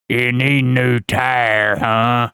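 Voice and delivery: loud, drawn-out yokel mechanic voice; hammed-up backwoods mechanic; sleazy mechanic. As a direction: drawl it out slowly and loudly, sizing up a victim. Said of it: "the guy behind the counter".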